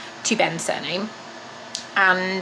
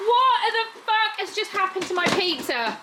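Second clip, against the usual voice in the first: higher, stunned question; disbelief; 8 semitones higher than usual. Real, not acted. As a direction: still shocked at the pizza. Shocked again.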